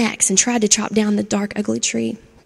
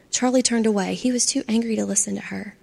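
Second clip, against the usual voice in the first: ordinary delivery, nothing marked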